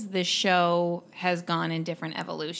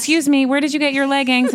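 high, loud, quick call to a stranger; 8 semitones higher, 9 dB louder, faster than usual. Retold or imitated. Driver hailing a runner about her leggings, calling out. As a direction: call out urgently, pitched high and fast.

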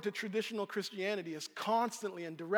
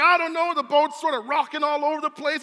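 high and loud, shouting back in a panic; frantic disciple; 7 semitones higher, 11 dB louder than usual. Acted. Andrew answers Philip in the storm, frantic shout. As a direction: shout back, scared of the rocking boat. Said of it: "He's like".

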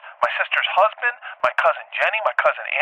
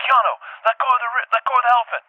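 high-pitched frantic urging; retold urgent plea; 10 semitones higher than usual. Teller to man holding baby elephant, acted urgent plea. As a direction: act out talking someone down in a panic.

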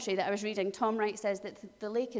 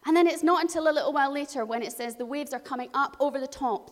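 normal talking voice

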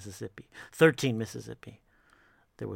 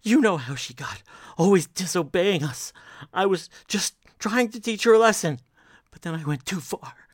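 put-on pleading higher and louder, like a child; groveling; 6 semitones higher, 7 dB louder than usual. Brooks clings to his wife's leg, groveling. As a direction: grovel loudly, voice climbing then dropping at the end. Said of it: "groveled like a child".

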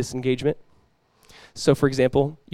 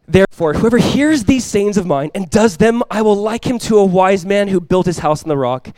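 raised, emphatic sermon reading; projected preacher's reading voice; scripture reading; 4 semitones higher, 4 dB louder than usual. Real, not acted. Reads scripture aloud to congregation.

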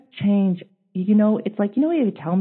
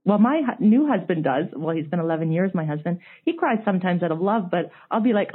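normal talking voice

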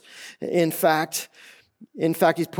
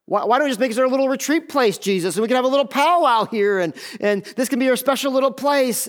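higher-pitched, naively eager Peter imitation; mock Peter; 7 semitones higher than usual. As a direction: pitch it up, pitching a cute little idea. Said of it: "And he goes, and he says this".

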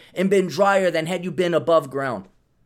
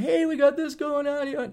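mock invitation; 9 semitones higher than usual. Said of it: "I am not flying".

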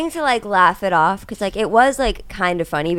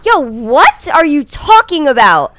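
slow, raised disbelief; baffled; 8 semitones higher, 7 dB louder, slower than usual. Retold or imitated. Realtor to the officer, slow and baffled. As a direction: stretch out the confusion. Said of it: "I was like".

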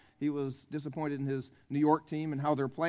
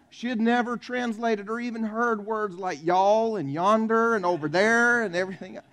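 ordinary talk, nothing marked

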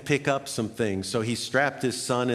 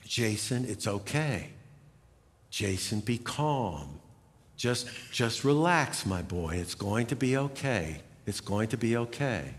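put-on softer and lower, gently hushing a screaming child; calm soothing voice; 5 semitones lower, 6 dB quieter than usual. Father calming his screaming son in the store, soft soothing voice. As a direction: soothe him, low and quiet, like a patient dad. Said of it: "with a calm and soothing voice".

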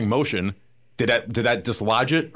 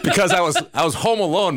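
laughing; 7 semitones higher than usual. Real, not acted. Laughing about tooth story.

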